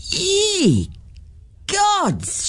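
put-on the teacher startled to see her old friend; mock shout. Miss Ferguson crying out in high surprise. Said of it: "shouted Miss Ferguson".

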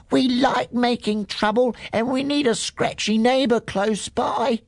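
high cheeky goat chorus; cheeky little goats. Acted. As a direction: kid goats teasing their neighbour. Little goats keep talking.

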